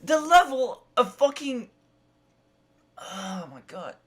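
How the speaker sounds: usual speaking voice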